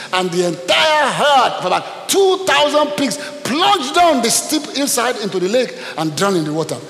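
loud, rising preacher's climax, slowing on plunged; dramatic reading. Acted. Preacher reading the pigs' plunge to his congregation, loud and rising.

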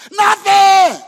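high, loud drawn scream. Real, not acted. Preacher screams the word again at the church.

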